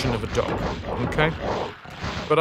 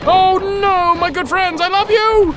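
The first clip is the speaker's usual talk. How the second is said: squeaky mock voice; 6 dB louder than usual. Sudden squeaky put-on outburst.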